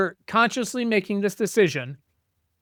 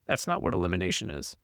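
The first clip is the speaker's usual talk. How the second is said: put-on sullen kid; 10 semitones lower, 6 dB quieter than usual. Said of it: "And he goes".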